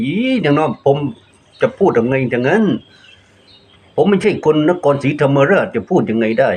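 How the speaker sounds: retold protest. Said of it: "ผมก็ว่าอย่างนี้นะ".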